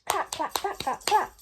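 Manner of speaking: high chanted clapping; children's chant of applause; chanted claps. A narrator's reading. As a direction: tap out the claps. Imitating the applause.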